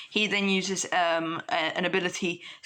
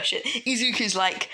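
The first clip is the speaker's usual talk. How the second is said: usual speaking voice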